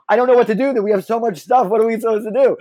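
high, loud panicked babble; hammed-up panic attack; panicking. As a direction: act out flustered panic, comically helpless. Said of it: "having a panic attack".

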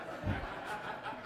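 quiet audience laughter; crowd laughter. Real, not acted. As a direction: congregation laughing. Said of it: "you gonna pay for that".

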